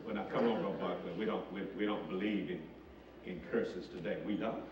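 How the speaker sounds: hushed, quick mock doubter muttering to the preacher; a hint of a skeptical church member, kept soft and quick.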